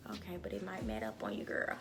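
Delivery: fast, whispered warning; hushed fast aside to herself. Real, not acted. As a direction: lean in and whisper it quickly. Private aside about the wig.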